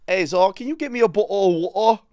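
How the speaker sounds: badly faked British English; bad British accent. Shown as a demonstration. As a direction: have a terrible go at a British accent. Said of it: "try my best British accent".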